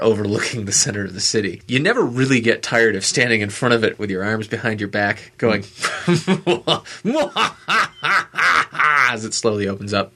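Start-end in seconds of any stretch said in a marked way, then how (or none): 5.7-9.1 hammed-up evil "mwahaha", building and higher; villain laugh. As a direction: laugh like a gloating cartoon villain. Villain laugh acted out at the window.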